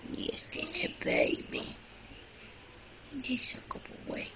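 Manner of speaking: soft unvoiced whisper. Real, not acted. Whispering over the baby.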